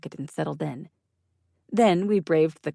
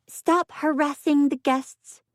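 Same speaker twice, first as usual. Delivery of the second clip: put-on breathless scolding girl; 11 semitones higher, 3 dB louder, slower than usual. A narrator's reading.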